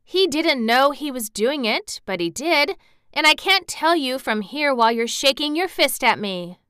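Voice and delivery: put-on stubborn child's retort; obstinate protest. A narrator's reading. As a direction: argue back stubbornly. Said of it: "she protested obstinately".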